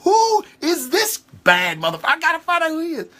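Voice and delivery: awed excitement. Retold or imitated. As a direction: gush it like you just found a hero. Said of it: "And I'm like".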